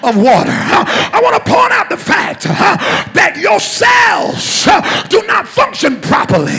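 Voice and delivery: rising sung preaching over the organ; half sing the lines to the church. Acted. Preacher listing water's benefits to congregation, sing-song preaching.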